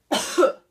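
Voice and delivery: one sharp loud cough; a sharp demonstration cough; deliberate cough. Shown as a demonstration. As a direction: cough hard to start the voice. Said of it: "like a cough".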